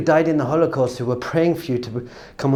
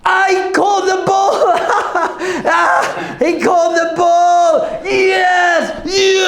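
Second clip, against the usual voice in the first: high, drawn-out victory yell; triumphant screaming; much slower than usual. Acted. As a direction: scream it like a player who just won. Acts out Super Bowl catch celebration.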